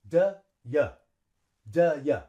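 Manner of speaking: "D, yeah" as two short beats, "duh, yuh"; quick schwa in both "D" and "yeah"; short reduced vowels. Shown as a demonstration. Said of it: "a little bit shorter".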